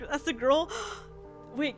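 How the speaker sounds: ordinary talk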